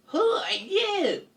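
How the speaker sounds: high, slow, startled voice; startled question. Acted. Caught bunny questions stranger, high startled voice.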